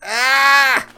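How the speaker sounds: very high, loud agonized scream; pained scream. Shown as a demonstration. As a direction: scream as if losing an arm. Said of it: "What are these sound effects?".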